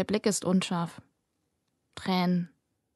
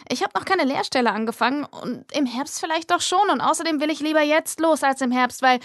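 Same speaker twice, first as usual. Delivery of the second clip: higher, hastig sich überschlagende Rede eines Mädchens; nervös sprudelnd; 6 semitones higher than usual. Acted. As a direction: alles schnell rauswerfen, bevor der Mut weg ist. Nervöse Rede vor dem Theater. (aufgeregt sprudelnd, deutlich höher)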